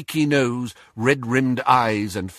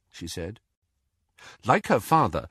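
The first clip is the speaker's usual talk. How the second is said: ordinary delivery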